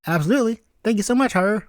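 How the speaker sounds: put-on high eager boy's voice; young Izuku, eager. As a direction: pitch up as an earnest little boy.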